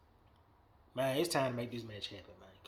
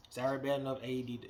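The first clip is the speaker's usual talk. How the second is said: normal talking voice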